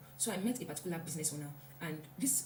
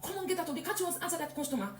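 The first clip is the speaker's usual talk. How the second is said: imagined boss scolding; 10 semitones higher, faster than usual. Pretend. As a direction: play the boss you braced for, snapping at a worker, all high pitch and no volume. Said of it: "I expect".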